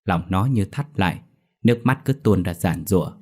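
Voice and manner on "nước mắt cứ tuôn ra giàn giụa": plain reading voice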